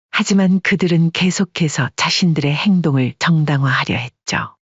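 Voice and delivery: ordinary delivery, nothing marked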